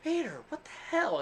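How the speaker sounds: high, shocked wife; exasperated wife. Retold or imitated. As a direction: squeal your disbelief at your husband. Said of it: "And she's like".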